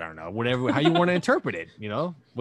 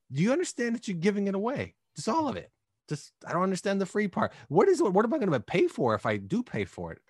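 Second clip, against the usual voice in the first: high-pitched, baffled questioning; incredulous; 9 semitones higher than usual. Acted. Podcaster recalling his reaction to free content, incredulous. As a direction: act out disbelief, pitched well up.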